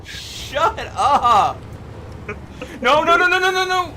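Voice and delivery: loud rising panicked no no no; screaming no at a near crash; panicked shout. Real, not acted. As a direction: yell in real alarm as the truck tips. Crash panic while driving.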